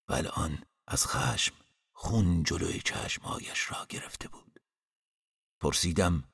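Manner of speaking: usual speaking voice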